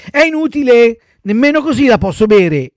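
put-on high, loud little-boy refusal; whining child puppet. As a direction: protest like a fussy child. Pinocchio refuses again. (burattino lamentoso, voce acuta)

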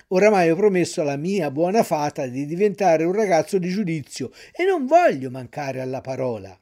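usual speaking voice

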